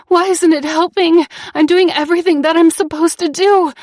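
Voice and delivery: high, loud panicked pleading; rising panic, voice climbing; frantic inner panic. A narrator's reading. Panic attack building.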